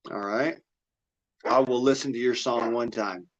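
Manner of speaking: usual speaking voice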